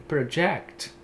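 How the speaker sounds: proJECT, second syllable stressed; verb stress. Shown as a demonstration. Teacher giving students the verb stress. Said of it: "transformando o projeto em projetar".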